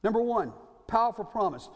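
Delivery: ordinary talk, nothing marked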